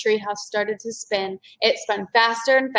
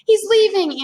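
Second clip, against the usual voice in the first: ordinary delivery, nothing marked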